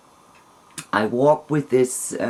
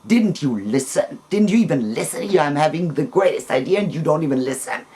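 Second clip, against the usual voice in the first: fast, indignant reproach; retold anger; 3 semitones higher, faster than usual. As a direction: imitate an offended outburst, rushed. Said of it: "I got really angry".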